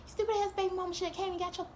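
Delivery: mock rant at him; retold angry rant. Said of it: "I was like".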